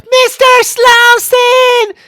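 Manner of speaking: high, very loud, slow call; imitated scream. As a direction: shriek the name in mock desperation. Acted call for missing man.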